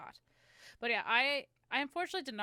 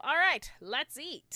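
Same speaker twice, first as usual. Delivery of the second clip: loud, high, drawn-out dinner call; mock rallying call; 6 semitones higher, 9 dB louder, slower than usual. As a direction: call the family to eat with playful gusto. Acting out family at dinner table.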